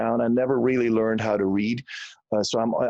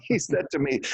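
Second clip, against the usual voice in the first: ordinary talk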